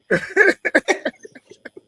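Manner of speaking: loud, high-pitched burst of laughter; big laugh. Real, not acted. Laughing at own story.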